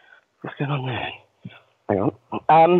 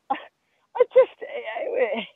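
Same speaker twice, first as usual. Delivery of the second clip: normal talking voice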